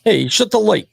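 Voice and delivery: put-on playful New York accent. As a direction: bark it like a New York parent. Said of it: "They say".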